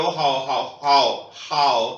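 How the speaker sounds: हाउ को चीनी hǎo की तरह, गिरते स्वर में; हाउ दोहराकर, नीचे उतरते सुर में; Chinese hao. Shown as a demonstration. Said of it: "हाउ, H A ओ". (चीनी उच्चारण सिखाते हुए हाउ)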